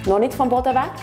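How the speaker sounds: ordinary talk, nothing marked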